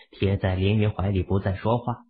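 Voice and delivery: ordinary delivery, nothing marked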